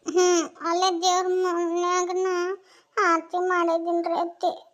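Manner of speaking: slow, high and sorrowful; tearful. Acted. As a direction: answer slowly, on the edge of crying. Tearful wife before husband leaves.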